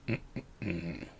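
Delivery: low, very soft hummed mumbles; hushed, hesitant "mm" noises; reluctant grunts. Acted. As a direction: hum doubtfully under your breath. Voices the reluctant retainer's wordless response.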